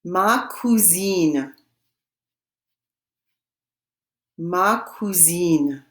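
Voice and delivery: slow, drawn-out French demonstration; slow French model. Shown as a demonstration. Teacher models the French for students to repeat, slowly. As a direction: say it very slowly for learners. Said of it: "and I will say it".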